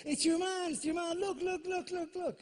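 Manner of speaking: high, insistent heckling cry; loud excited lad. Retold or imitated. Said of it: "one of them really loudly went".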